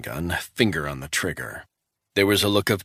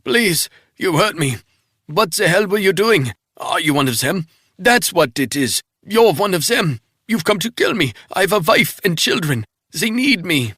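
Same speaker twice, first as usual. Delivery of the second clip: high, loud, desperate begging; panicked pleading; 12 semitones higher, 7 dB louder than usual. Acted. Wounded man pleading with Resnick not to kill him. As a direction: beg for your life, frantic. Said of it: "The guy on the ground moaned".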